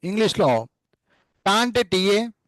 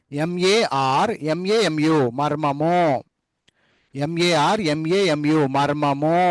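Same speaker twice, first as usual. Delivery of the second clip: slow spelling out; 2 semitones lower, much slower than usual. Shown as a demonstration. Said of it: "చెప్పేటప్పుడు మాత్రం MAR అనాల".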